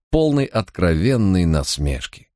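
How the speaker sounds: usual speaking voice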